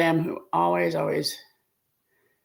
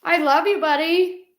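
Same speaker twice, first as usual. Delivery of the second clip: tearful; 8 semitones higher, 4 dB louder than usual. Real, not acted. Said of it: "It makes me cry".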